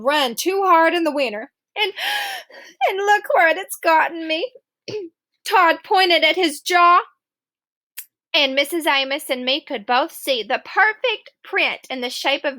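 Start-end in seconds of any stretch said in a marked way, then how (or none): none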